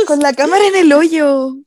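loud, high burst of shocked delight; incredulous loud exclamation. Real, not acted. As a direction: blurt it out in disbelief. Amiga cuenta cámara en el hoyo. (exclamación incrédula y fuerte, muy aguda)

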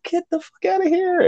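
very high-pitched incredulous squeal; retold disbelief. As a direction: pitch shoots up in mock disbelief. His disbelief at nineteen years.

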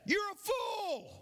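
very high, drawn-out taunt; acted high holler. Boy taunting the unseen voice, drawn-out high holler. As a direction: stretch the insult out in a high call. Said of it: "he screamed out".

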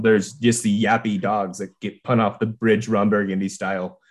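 ordinary delivery

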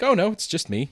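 forced upbeat. Retold or imitated. Telling the waitress he's alone, fake upbeat. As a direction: sell it as totally fine. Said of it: "make it seem, like, very upbeat".

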